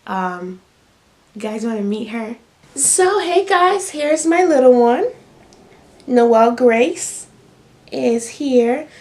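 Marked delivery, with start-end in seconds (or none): 2.8-8.9 higher, slow, bright-eyed hello; excited introduction. Real, not acted. Introducing her newborn.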